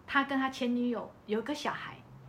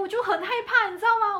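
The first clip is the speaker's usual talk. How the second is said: much higher and louder telling; worked-up fear; 10 semitones higher, 9 dB louder than usual. Real, not acted. Telling hosts about the car, loud and worked up. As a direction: relive the fright.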